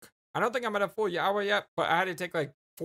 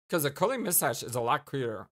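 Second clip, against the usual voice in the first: normal talking voice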